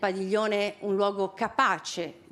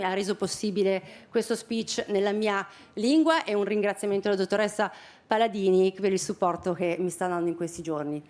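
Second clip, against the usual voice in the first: ordinary delivery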